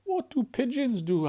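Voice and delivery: chirpy small Pip asking; little bird voice. Acted. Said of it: "asked Pip".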